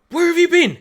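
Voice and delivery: high, loud angry shout; barging in yelling. Acted. Jun Lena yells at the influencer to come, loud. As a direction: storm in demanding at full volume. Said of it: "she comes barging out, and she's like".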